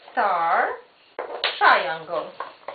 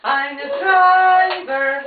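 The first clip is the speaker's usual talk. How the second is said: proud driver; 6 semitones higher, 7 dB louder, slower than usual. Pretend. Playing driver for child.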